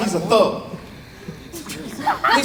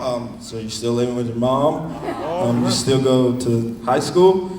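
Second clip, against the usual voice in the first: mock slow question; 11 semitones lower, 3 dB quieter than usual. Teller mocks his own questions.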